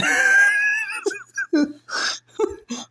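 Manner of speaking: very high, long laughing fit; shrieking laughter. Real, not acted. Storyteller cracking up over the cowboy's insult, shrieking laugh. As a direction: lose it completely in high-pitched laughter.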